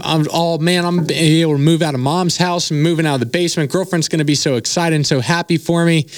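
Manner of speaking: bubbling over about the big payday; retold excitement. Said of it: "I'm excited".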